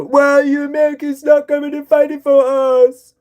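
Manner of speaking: whiny pleading European. Retold or imitated. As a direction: beg for help like a petulant ally. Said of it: "you go".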